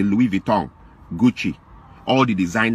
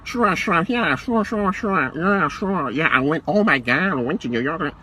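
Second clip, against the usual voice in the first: mock fake American accent; 5 semitones higher, slower than usual. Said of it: "I don't speak like that".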